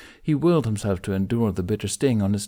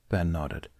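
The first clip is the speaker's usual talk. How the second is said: ordinary delivery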